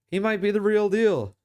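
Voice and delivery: high, loud excited claim; hyped. Real, not acted. Streamer hyping Browning to viewers, excited. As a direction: sell the hype to chat.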